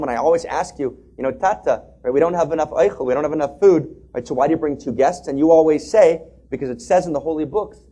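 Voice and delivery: put-on a girl begging her father to listen; pleading daughter.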